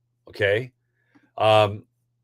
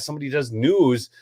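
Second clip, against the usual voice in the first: ordinary talk, nothing marked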